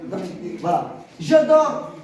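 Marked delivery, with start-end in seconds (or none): 1.2-1.9 emphatic example. Shown as a demonstration. Teacher calls out example sentence.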